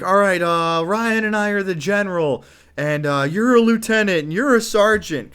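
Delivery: loud, high drill-sergeant orders; commanding announcement. Retold or imitated. Narrator assigning military ranks to the kids, loud and commanding. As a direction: bark out ranks like a general.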